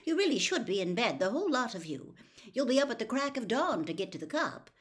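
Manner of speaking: motherly scolding. Acted. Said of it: "Missus Weasley said suddenly".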